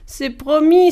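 high, loud, eager pledge; exclaimed promise. A narrator's reading. Spirit promising the spider before leaving, exclaiming. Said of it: "s'exclama l'esprit".

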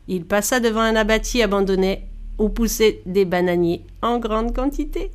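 ordinary delivery, nothing marked

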